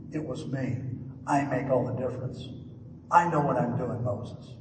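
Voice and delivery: low, soft voice of God; God's calm answer. Acted. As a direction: settle it with quiet authority. Voicing God answering Moses.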